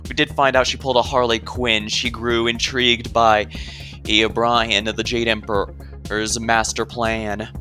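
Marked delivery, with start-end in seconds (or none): none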